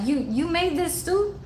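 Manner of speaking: high-pitched imitation of a questioning man; Larry. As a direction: ask it high, in playful mimicry. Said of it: "he'll be like".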